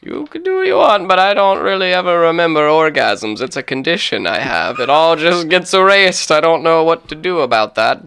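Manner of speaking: hammed-up captain, high start easing down; Christopher Columbus. Captain answers the prisoner about his condition, hammed-up lofty voice.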